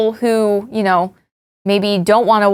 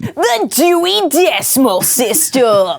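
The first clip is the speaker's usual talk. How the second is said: YouTuber voice; 3 semitones higher, slower than usual. Pretend. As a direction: hype up the Dewey Decimal System. YouTuber voice bit continues.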